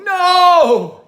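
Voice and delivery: very high, loud desperate cry; anguished shout. A narrator's reading. Ogmont as his fox is thrown from the train, shouting. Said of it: "he shouted".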